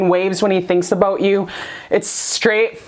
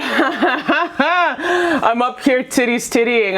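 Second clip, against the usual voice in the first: laughing; much slower than usual. Real, not acted. Jokes about not wearing a bra.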